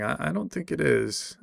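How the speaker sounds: ordinary delivery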